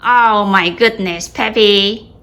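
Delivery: loud, slow startled cry; startled exclamation. Real, not acted. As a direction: blurt it out, caught off guard. Startled by the dog.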